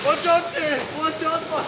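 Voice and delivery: high-pitched panicked warning; alarmed yell. Acted. Bob warning Dave as the boat crashes, high and panicked. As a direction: yelp it up high as the boat careens.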